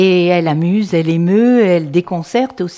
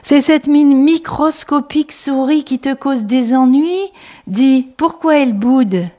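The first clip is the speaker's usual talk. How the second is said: storytelling refrain; 6 semitones higher than usual. Acted. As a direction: call out the refrain to kids. Refrain repeated.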